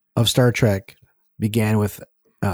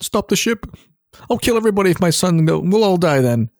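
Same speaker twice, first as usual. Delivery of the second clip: high, frantic mock captain; mock Picard; 7 semitones higher than usual. Mocking Picard's scene to cohost, high frantic parody. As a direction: ham up a panicked captain's order.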